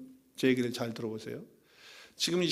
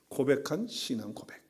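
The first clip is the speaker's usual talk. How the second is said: ordinary delivery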